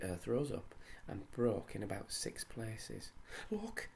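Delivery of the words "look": usual speaking voice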